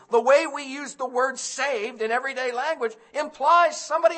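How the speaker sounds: loud, high, urgent preaching; preaching at full force. Acted. Preacher warning the congregation, loud and high. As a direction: thunder the warning at the sinners.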